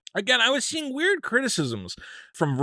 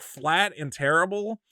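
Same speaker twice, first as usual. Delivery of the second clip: ordinary talk, nothing marked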